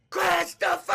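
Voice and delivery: loud, high call across the neighborhood; calling kids to dinner. Retold or imitated. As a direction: yell the name out the window. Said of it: "she used to call us for dinner".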